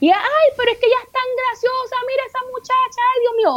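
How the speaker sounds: very high, hammed-up fawning; imitating gushing admirers. Neighbours raving about the funny little girl, mock gushing. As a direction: gush in a high mock busybody voice. Voicing admirers from her childhood.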